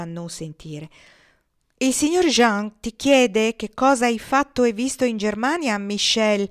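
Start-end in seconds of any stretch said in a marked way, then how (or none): 1.8-6.5 calling out, much louder. A narrator's reading. Joël loudly repeating the question to distracted Michel. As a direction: raise the voice so he hears. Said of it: "disse molto forte Joël".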